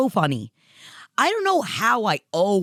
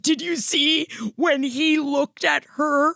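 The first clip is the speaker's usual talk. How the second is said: much higher, slow, swooning fan squeal; gushing fan; 11 semitones higher, slower than usual. Retold or imitated. Mocking fans gushing over the couple, high squeals. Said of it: "And everyone's like".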